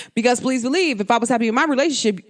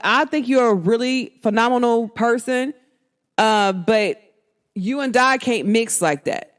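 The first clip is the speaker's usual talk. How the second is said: normal talking voice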